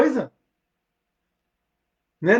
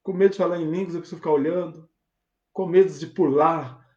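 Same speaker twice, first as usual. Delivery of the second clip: ordinary talk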